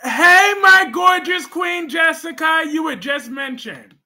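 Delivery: high, loud greeting call; excited shout. Real, not acted. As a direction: shout a big hello to a favourite viewer. Greeting a viewer who joins the stream.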